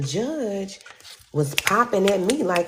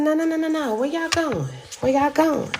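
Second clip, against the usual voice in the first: alarmed calling out; 11 semitones higher than usual. Retold or imitated. Catching them leaving.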